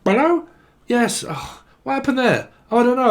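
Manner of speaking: high-pitched caller voice; mock phone call. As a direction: squeak through a patchy call. Acts out broken phone conversation.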